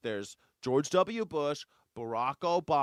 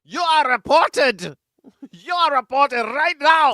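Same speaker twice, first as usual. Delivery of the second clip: hammed-up, much higher and louder outraged yell; mock shout; 12 semitones higher, 14 dB louder than usual. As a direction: scream it like an offended snitch. Mocking outraged reporter.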